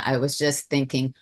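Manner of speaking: ordinary talk, nothing marked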